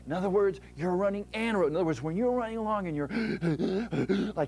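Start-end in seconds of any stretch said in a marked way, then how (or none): 1.7-3.1 fast, then a demo gasp for breath; mock gasping. Shown as a demonstration. Runner demonstrates gasping for air, fast and breathless. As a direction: act out being out of breath. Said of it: "you're breathing like that".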